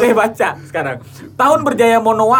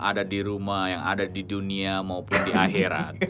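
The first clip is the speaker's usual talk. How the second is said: put-on old radio announcer; 10 semitones lower, 8 dB quieter than usual. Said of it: "announcer banget".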